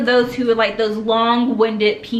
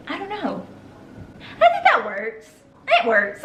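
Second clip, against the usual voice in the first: squeaky high put-on voice, unsure; silly high voice; 10 semitones higher than usual. Mocking a ditzy speaker in a squeaky voice. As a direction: pitch way up into a cartoonish squeak. Mock voice after quoting literally.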